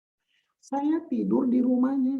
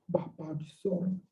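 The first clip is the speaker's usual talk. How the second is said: hushed, lower, put-on reverence; mock-pious prayer voice; 4 semitones lower, 8 dB quieter than usual. Preacher showing how the voice changes at prayer, mock-pious. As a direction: drop into a soft fake-holy praying voice. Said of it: "suara lu gua".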